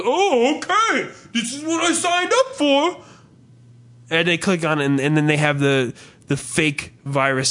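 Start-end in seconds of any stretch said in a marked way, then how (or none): 0.0-3.0 very high, naive put-on voice; mock a clueless happy clicker; squeaky innocent simpleton; gullible dupe. Voices a gullible page follower.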